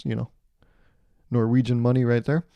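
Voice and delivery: normal talking voice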